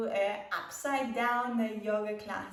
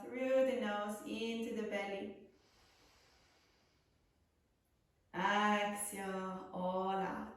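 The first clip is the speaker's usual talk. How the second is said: guided meditation. Acted. Leads a breathing meditation.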